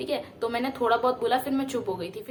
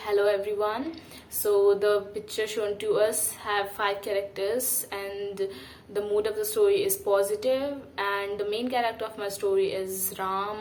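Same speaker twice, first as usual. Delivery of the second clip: slow formal intro speech; much slower than usual. Retold or imitated. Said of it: "मैंने start किया कि".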